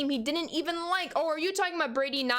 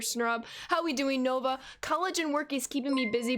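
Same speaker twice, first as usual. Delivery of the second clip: usual speaking voice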